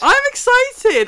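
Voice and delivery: very high, slow squeal; squeaky excited. Real, not acted. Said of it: "I went about, like, five octaves then".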